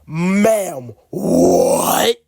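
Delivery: loud, drawn-out disbelief; incredulous outburst. Acted. Student reacting to teacher's French accent comparison, loudly incredulous. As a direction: blurt it out, stretching the stunned question.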